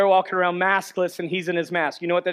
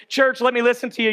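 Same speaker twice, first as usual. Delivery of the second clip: ordinary talk, nothing marked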